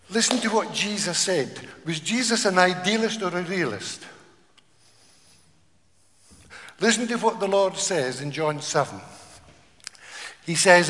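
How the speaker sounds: loud, rousing pulpit call; rally the congregation; full-force preacher's call; fervent preaching. Real, not acted.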